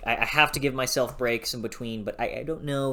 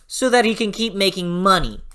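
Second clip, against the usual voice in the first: ordinary talk, nothing marked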